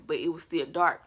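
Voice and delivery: normal talking voice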